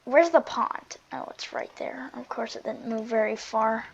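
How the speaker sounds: ordinary talk, nothing marked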